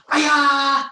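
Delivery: loud, high martial arts kiai; sharp karate-class battle cry; loud yell. Shown as a demonstration. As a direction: yell it out high, falling away at the end. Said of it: "Loud yell".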